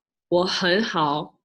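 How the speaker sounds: slow "我很好" with clear tones; Mandarin pronunciation. Shown as a demonstration. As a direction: model "我很好" carefully for learners. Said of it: "you could say".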